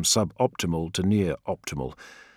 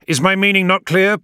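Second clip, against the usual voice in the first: much higher and louder, rising anger; irritated challenge; 13 semitones higher, 9 dB louder than usual. A narrator's reading. Leader challenging his doubting adviser, irritated and loud. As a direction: demand it, losing patience.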